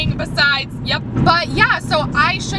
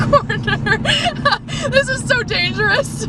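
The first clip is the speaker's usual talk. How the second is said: nervous laugh; 7 semitones higher than usual. Real, not acted. Laughing nervously while turning corner.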